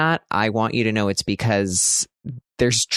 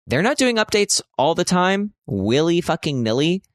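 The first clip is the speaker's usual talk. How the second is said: exasperated rant; 8 semitones higher than usual. Real, not acted. Vlogger griping to viewers about updates, exasperated. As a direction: vent it, pitch jumping up.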